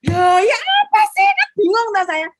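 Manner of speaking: loud, high-pitched outburst of confusion; acted-out bewildered yelp; exasperated bafflement. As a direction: throw up a baffled, exasperated protest. Voicing her exasperated bafflement.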